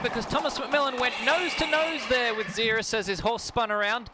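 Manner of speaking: ordinary delivery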